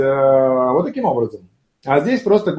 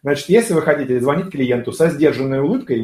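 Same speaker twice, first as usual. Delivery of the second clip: normal talking voice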